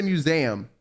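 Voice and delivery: "museum" said as myoo-ZAM; own pronunciation. Shown as a demonstration. Streamer checking friend's usage, own pronunciation of "museum". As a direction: say "museum" with ZAM in place of zee-um. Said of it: "So you don't say museum".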